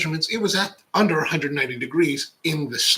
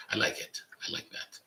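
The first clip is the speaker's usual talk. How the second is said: whispered aside; 10 dB quieter than usual. Real, not acted. Unvoiced aside.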